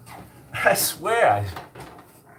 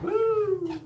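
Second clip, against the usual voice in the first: excited woo. Real, not acted. Excited cheer.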